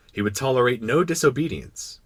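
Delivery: usual speaking voice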